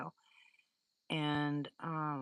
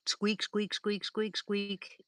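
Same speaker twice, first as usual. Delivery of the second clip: high squeaky imitation of a dog toy; playful high-pitched squeak toy imitation; squeaky toy noise; 4 semitones higher, much slower than usual. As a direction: mimic the toy squeaking away in the dark woods. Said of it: "that squeak toy going crazy".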